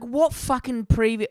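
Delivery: high, slow mock outrage; retold outburst. Retelling his outburst at the mechanic, mock outrage. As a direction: spit out the disbelief. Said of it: "I was like".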